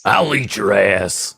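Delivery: slow, put-on ranter's voice; Alex Jones. Repeating the quote for a co-host, slow ranter impression. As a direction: draw it out like a ranting radio host. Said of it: "You do a pretty good Alex Jones".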